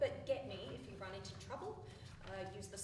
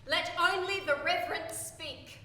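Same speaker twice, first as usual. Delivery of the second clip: solemn command; 5 semitones higher, 9 dB louder than usual. Acted. Cult leader's solemn proclamation.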